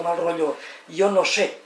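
ordinary talk, nothing marked

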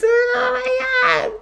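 very high, drawn-out mock wail; imitated producer's outburst. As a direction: stretch it into a high, hammed-up squeal. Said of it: "She does this".

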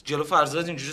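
ordinary talk

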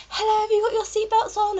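high-pitched mock cabin-crew voice; chirpy stewardess. Stewardess checking passengers' seatbelts, high mock-polite voice. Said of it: "all the stewardesses are like".

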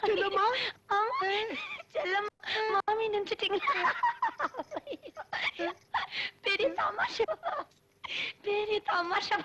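laughing too hard to get words out; broken up by laughter; giggling. Acted. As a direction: giggle helplessly between the words. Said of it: "ஏன் இப்படி சிரிச்சிட்டு வர?".

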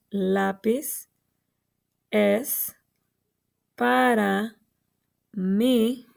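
isolated words with a clear pause after each; word-by-word reading. Shown as a demonstration. Teacher pointing at each word for children, pausing between words. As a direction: read one word, pause, then the next. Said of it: "Cada vez que digo una palabra, apunto".

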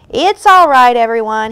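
high, loud opening, then easing; calling out. A narrator's reading. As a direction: call to everyone hiding that it's safe. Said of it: "he called".

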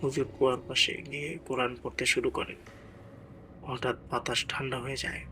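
ordinary delivery

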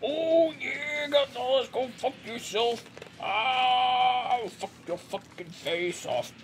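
high, drawn-out wrestler voice; strained wrestler trash talk held back in volume; Macho Man. Acted. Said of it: "I'll take this Macho Man".

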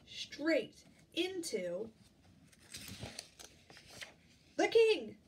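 stretched-out pause then loud high the king; slow down, then burst out on the king; dramatic reveal. Acted.

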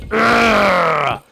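high, loud growling roar; long playful monster growl; effort roar. Pretend. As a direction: roar as you attack the wall. Straining at the building.

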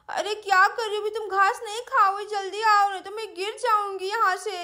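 put-on babyish, lisping child voice, whimpering; little girl in trouble. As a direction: plead tearfully like a stranded small child. Voices Sweety crying, calling cow. (तुतलाती छोटी बच्ची की रुआँसी गुहार)

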